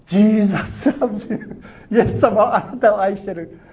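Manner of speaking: high, slow, loud shout with a laugh at the end; loud call. Retold or imitated. The voice reaching the despairing man, loud and joyful. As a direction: ring it out joyfully. Heard at mailbox at prayer moment.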